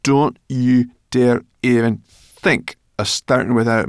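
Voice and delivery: slow, loud, word-by-word threat; bossy creature's stern warning; menacing. A narrator's reading. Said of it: "she added menacingly".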